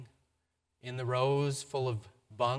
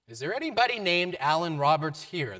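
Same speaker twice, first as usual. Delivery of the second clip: raised voice calling a name across a shelter; 5 semitones higher, 8 dB louder than usual. Retold or imitated. Shelter worker calling for Alan across the bunks, loud.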